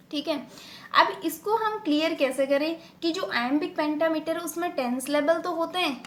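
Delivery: ordinary talk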